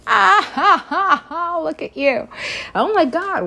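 high, loud gleeful laughing; delighted laugh. Real, not acted. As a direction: squeal with delight. Delighted at cute character.

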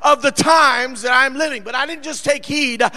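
forceful preacher, punching key words loud; preaching. Real, not acted.

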